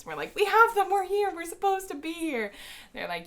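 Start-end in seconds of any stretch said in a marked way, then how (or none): 0.3-2.5 high, urgent, eager insisting; nervous squeak. Retold or imitated. Fans assuring security guard, high and flustered.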